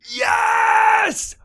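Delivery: gasp, then high, loud, stretched-out cheer; ecstatic shout. Real, not acted. Player discovering the chest restocked, ecstatic shout. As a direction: cry out in astonished triumph.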